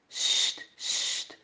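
put-on breathy sst to silence the children; hushing whisper. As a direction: parents shushing so nobody hears. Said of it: "zeggen de vader en moeder".

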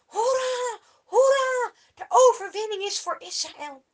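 loud, high jubilant hooray; cheering. Acted. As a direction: cheer it out like a crowd. Israëlieten juichen. (de Israëlieten juichen: luid en hoog jubelend)

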